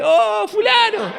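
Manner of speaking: saludo empalagoso, mucho más agudo y lento; saludo falso. Retold or imitated. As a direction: finge alegría exagerada al ver al amigo. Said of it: "Y él dice". (el señor saluda falsamente al camionero, agudo y empalagoso)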